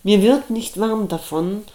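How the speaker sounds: ordinary delivery, nothing marked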